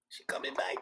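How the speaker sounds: hushed whisper, breath only; a soft secretive whisper to the viewers. Real, not acted. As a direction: lean in and whisper it. Host repeats softly to audience.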